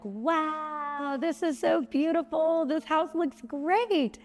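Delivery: high, delighted gush over the house; mock-wowed homeowner. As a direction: rave like you love what you see. Gushing over the finished house.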